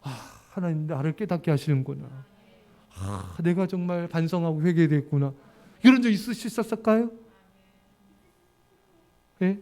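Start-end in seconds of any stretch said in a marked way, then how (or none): 0.0-5.3 soft, low and humble; humble realization. Retold or imitated. Believer quietly realizing God's lesson, soft and low. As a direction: murmur it like a grateful realization. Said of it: "원망 터뜨리는데".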